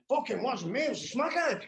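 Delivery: high mock voice of a cokehead passing the blame; a lightly voiced cokehead, high and innocent, dodging the blame; mocking cokehead excuse. Cokeheads blaming the smackheads.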